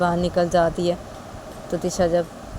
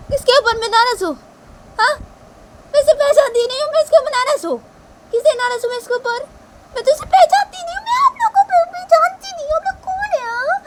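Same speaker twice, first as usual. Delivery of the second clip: very high, loud, panicky voice; piercingly high distraught character; hysterical Shanaya; 6 dB louder than usual. Acted. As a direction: shriek in confused protest. Shanaya denies knowing them.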